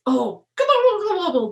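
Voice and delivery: high gobbling turkey; turkey gobble. A narrator's reading. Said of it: "the turkey".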